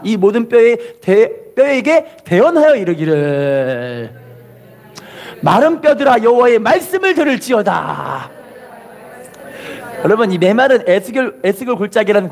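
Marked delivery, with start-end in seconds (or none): 0.0-8.3 slow declaration with long held endings; call-and-repeat proclamation. Shown as a demonstration. Preacher has congregation repeat Ezekiel's verse, drawn-out proclamation. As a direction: stretch 이르기를 and 들을지어다 out at the end. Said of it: "따라합시다".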